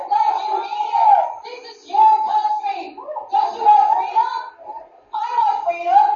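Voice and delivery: very high, loud rallying cry; fervent shout. Real, not acted. Preacher urges the congregation to cry for freedom, shouting. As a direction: whip the crowd up.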